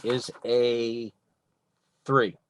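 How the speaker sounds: normal talking voice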